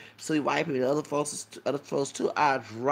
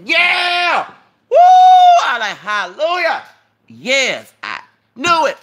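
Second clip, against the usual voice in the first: high-pitched, full-volume whoops of praise; exultant shouting; 18 dB louder, slower than usual. Retold or imitated. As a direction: whoop it out like a hallelujah in church. Reliving joy at answered prayer.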